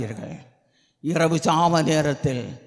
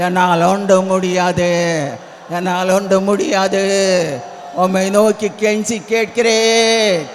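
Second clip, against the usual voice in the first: loud, sustained cry to God, rising in intensity; fervent pleading prayer; 6 semitones higher, 8 dB louder than usual. Real, not acted. Preacher begging the Lord to come along for souls, fervent prayer. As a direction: plead at full force, stretching the line endings.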